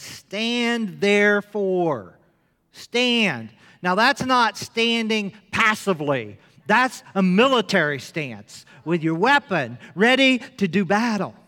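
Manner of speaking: loud, slow commanding proclamation; preaching. Acted. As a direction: preach it like a battle order. Preaching at full force.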